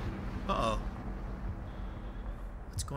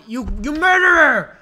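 loud, higher-pitched cry on MURDERER; hammed-up outburst at a villain; mock accusation; 4 semitones higher, 8 dB louder than usual. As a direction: accuse them with playful outrage. Mock accusation at game mother.

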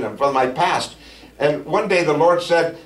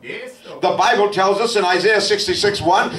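raised, drawn-out preaching voice; fervent preaching; 6 semitones higher than usual. Acted. Pastor telling the congregation how mighty God is, fervent. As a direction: lift the church up with slow emphasis.

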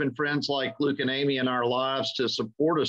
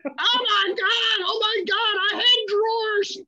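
put-on shrieking high-pitched New York accent; thick New York accent; 4 dB louder than usual. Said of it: "With that thick, thick New York accent".

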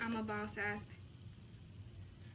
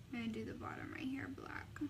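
soft whisper to herself while working; 9 dB quieter than usual. Real, not acted. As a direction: breathe the words out, toneless. Whispering while doing makeup.

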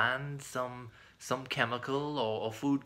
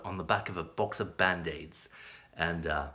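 ordinary talk, nothing marked

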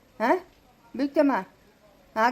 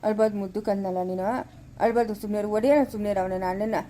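put-on soothing a crying child; 5 semitones lower than usual. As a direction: coo gently to calm the baby.

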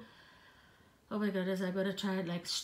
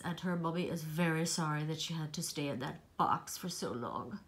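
ordinary delivery

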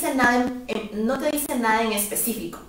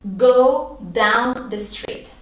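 slow, careful teaching repetition; slow model phrase; much slower than usual. Shown as a demonstration. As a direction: Go down the street said very slowly. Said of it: "Yo voy a decir".

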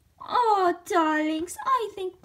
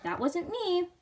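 usual speaking voice

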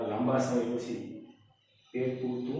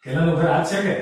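loud, higher-pitched call to the house; 6 semitones higher, 12 dB louder than usual. Acted. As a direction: call out a question to whoever is inside. Calling out at a house.

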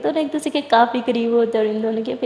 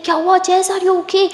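raised, higher-pitched worried call; concerned calling; 7 semitones higher than usual. A narrator's reading. Sara outside the door as Jazz screams, worried call. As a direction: call out with acted alarm.

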